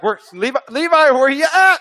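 dad calling for his missing son in Kmart, loud and high. Retold or imitated. Father calling for lost son.

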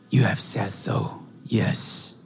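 low, soft, still answer; soft reply. Retold or imitated. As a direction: say it barely above a breath. Jesus answers governor, very soft.

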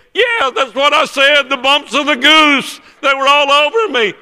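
exasperated insistence. Retold or imitated. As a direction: cry it out as if obviously right. Friend insists when corrected.

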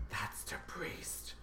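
slow, breathy whisper; hushed whisper. Retold or imitated. Telling friends the priest just walked in, whispered. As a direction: whisper it so the priest won't hear.

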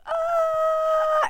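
excited fan squeal. Retold or imitated. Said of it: "they were like".

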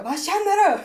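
put-on shouting at a girl standing in the snow at midnight; retold shout. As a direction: yell the question at her, laughing. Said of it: "Och så skrek jag".

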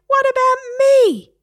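very high, loud parrot protest; shriek. Acted. Parrot protesting his fate, outraged shriek. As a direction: shriek it, falling off at the end. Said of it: "shrieked Harry".

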